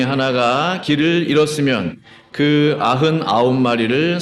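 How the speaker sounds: slow, measured reading with stretched phrase ends; deliberate unison scripture reading. Real, not acted. Pastor leading the congregation through four verses together, deliberate reading. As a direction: read each phrase out distinctly for others to follow. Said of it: "다 같이 또박또박 네 구절 같이 읽겠습니다".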